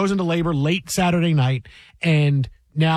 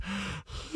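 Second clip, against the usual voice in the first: long exaggerated yawn; big yawn sound; 2 semitones higher, 17 dB quieter than usual. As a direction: stretch out a lazy yawn. Imitates his big yawn.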